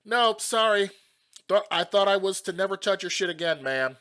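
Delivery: loud, high shouted refusal; yelling back. Acted. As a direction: call back pointedly across the yard. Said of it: "I look over and yell back".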